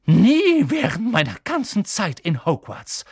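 loud, outraged; appalled outburst; horrified. Acted. McGonagall scolding Harry, horrified and loud. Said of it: "fast sprachlos vor Entsetzen".

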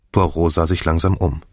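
plain reading voice

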